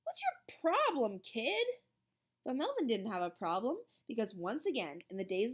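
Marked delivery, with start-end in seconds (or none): 0.0-1.7 high, drawn-out confrontation; annoyed challenge. A narrator's reading. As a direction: round on him, pitched high. Calvin rounds on Melvin.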